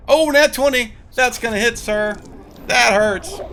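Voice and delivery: loud, high excited call. Real, not acted. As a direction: shout out the critical roll. GM calling critical roll.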